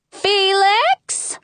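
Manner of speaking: high-pitched holler, rising at the end; mother calling out sharply; queen hollering. A narrator's reading. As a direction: call out for a child in another room. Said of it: "the queen hollers".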